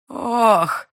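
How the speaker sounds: loud, high groan of pain. A narrator's reading. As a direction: groan as if bitten hard. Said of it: "только и простонал оборотень".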